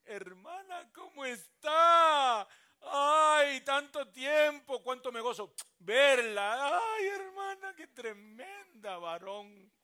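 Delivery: loud, high, slow gushing sister; mock-sweet churchgoer gushing over a sister; overdone church greeting. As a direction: ham up the fake welcome, dragging the words.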